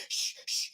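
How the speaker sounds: two short hushes; shushing. Retold or imitated. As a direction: imitate a pastor shushing someone. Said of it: "telling the lady".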